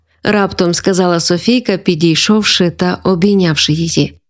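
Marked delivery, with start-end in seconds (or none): none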